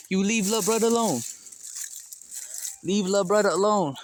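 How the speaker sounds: firm loud scold; scolding the bully cat. Real, not acted. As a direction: tell the cat off.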